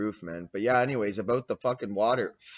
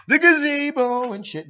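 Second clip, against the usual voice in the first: high, slow announcing voice; mock tour guide to viewers, high and slow; 13 semitones higher, 7 dB louder, slower than usual. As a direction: present it like a grand tour.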